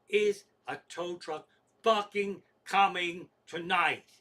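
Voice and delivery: slow, loud, word-by-word demand; deliberate, emphatic irate caller; really mad. Retold or imitated. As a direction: spell out the question, out of patience. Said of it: "Now I'm really fucking mad".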